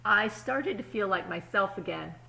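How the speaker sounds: put-on calm storybook reading to kids; story narration. A narrator's reading. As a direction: read it like a picture book at story time. Reads the story aloud.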